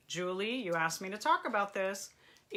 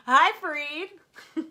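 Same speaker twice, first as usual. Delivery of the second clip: higher, louder and drawn out slowly; excited greeting; 7 semitones higher, 9 dB louder, much slower than usual. Real, not acted. Therapist greeting her husband as he joins the stream: slow, loud, excited hello. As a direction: sing out a slow, bright hello to him.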